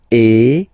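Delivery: loud closed e; closed French é. Shown as a demonstration. Teacher recapping accent aigu sound. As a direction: lips spread for é. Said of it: "accent aigu, remember".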